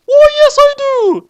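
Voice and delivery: very high, loud squeaky mom voice; falsetto mom. Acted. Light's mom greeting the doorman. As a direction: squeak it comically.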